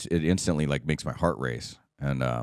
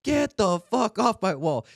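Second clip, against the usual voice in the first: much higher, slow, drawn-out drill sergeant bark; drill instructor yelling; slower than usual. Retold or imitated. Drill instructor ordering Pyle off the wall, slow high mock bark. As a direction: drag each word out like an angry sergeant. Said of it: "he was yelling at him".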